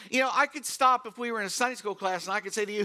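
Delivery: normal talking voice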